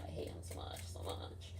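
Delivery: hushed whisper of hatred. Real, not acted. Confessing hatred of her uncle, whispered. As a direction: breathe it out as a secret. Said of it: "whispered aside".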